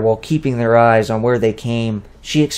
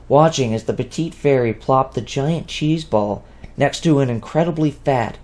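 plain reading voice

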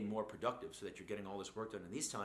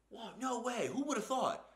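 high, louder fake astonishment, half laughing; mock amazement; 13 semitones higher, 4 dB louder than usual. Someone mocking the news they just heard, in mock amazement. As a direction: ham up the shock sarcastically. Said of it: "and then you could be like".

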